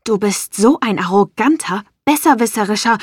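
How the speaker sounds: furious. Acted. Sloan insults Lucian after the old nickname, furious. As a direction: lash out at him in rage. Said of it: "Ich war voller Zorn".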